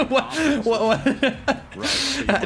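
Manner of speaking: high, laughing stammer of disbelief; laughing hard. Real, not acted. Cracks up at own stream.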